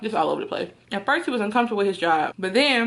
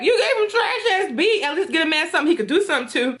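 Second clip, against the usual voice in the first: laughing protest; 7 semitones higher than usual. Retold or imitated. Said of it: "and I'm like".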